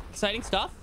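usual speaking voice

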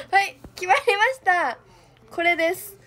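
loud, bright announcement; triumphant call; excited. Real, not acted. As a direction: proclaim it loudly.

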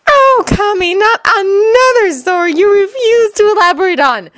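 high, wailing groan; groaning exasperation. Acted. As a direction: groan in high-pitched dismay. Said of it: "Sakura groaned".

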